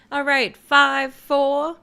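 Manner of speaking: loud, high countdown call; hyped countdown. Real, not acted. Countdown to going live.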